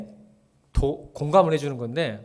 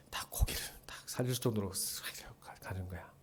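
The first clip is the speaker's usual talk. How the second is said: whisper; 8 semitones lower, 16 dB quieter than usual. Acted. Whispered advice, just go.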